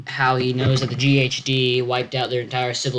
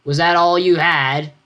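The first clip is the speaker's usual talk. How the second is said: louder, slow jeering challenge; mocking taunt; 5 semitones higher, 6 dB louder, slower than usual. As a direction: goad him with scorn. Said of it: "Alfred began to laugh".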